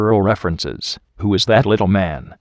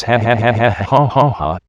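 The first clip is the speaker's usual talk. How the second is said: mock laugh. Mock evil laugh after insult.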